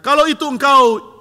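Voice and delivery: high, loud bold call; loud, high daring challenge; Peter calling out. Retold or imitated. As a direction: shout across the lake at the figure.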